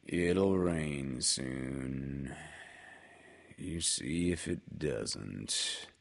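low, slow, gloomy donkey; gloomy Eeyore. A narrator's reading. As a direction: sigh it out like a mournful old donkey. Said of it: "nodded gloomily".

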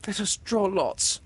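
put-on high, brave girl voice; bravely. A narrator's reading. Windy to Peter on the rock, said bravely. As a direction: offer it bravely. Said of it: "Windy said bravely".

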